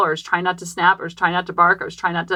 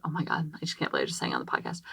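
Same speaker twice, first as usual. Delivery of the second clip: fast, embarrassed whisper to herself; 13 dB quieter, faster than usual. Real, not acted. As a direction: hush it like a secret. Embarrassed aside after singing.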